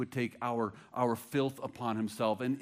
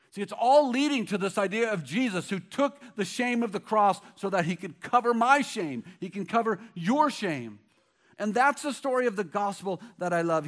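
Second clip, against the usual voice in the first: loud, raised, fervent gospel preaching; preaching at full force; 8 semitones higher, 9 dB louder than usual. Acted. Pastor rallying the congregation about the gospel, fervent loud preaching. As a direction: drive it home to the congregation with full fire.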